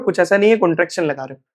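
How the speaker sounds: normal talking voice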